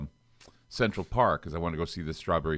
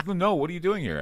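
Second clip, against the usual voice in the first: surprised; 11 semitones higher than usual. Retold or imitated. Insistent acquaintance quoted.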